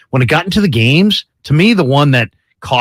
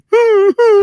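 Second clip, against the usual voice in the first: high, thin imitated dog whine; mock high-pitched dog whimpering; freaked-out dog whimper; 3 dB louder than usual. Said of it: "the dog freaks out".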